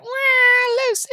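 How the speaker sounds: very high comic wail; Lucille Ball. Retold or imitated. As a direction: wail it in a squealing sitcom impression. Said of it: "doing the Lucy impression".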